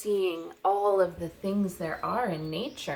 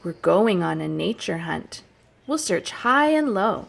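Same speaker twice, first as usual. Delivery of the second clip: rhythmic, sing-song story chant; reading to children; 5 semitones higher, 11 dB louder, slower than usual. Acted. Teacher reading a nature rhyme to children, sing-song. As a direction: read it like a picture book for little kids.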